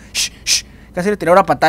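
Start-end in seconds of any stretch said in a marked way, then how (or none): 0.1-0.6 whispered shushing; breathy unvoiced shh; hushing shh. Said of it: "casi diciéndole como".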